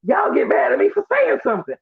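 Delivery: high, fast, whiny protest with a shouted start; exasperated self-defence. Retold or imitated. He snaps back at the room, high exasperated shout. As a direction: plead your case way up high, almost shouting. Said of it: "I'm like".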